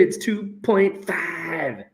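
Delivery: slow, soft statement of the result; quiet announcement. Real, not acted. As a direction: say the figure slowly and softly. First, low take of the answer.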